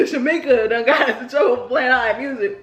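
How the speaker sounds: ordinary talk, nothing marked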